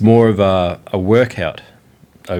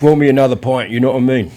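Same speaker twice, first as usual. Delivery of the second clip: mock London pub cockney, quick and louder; hammed-up cockney pub banter; cockney geezer voice; 5 semitones higher, 4 dB louder, faster than usual. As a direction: play a cheeky cockney geezer at the bar. Aussie doing London pub accent.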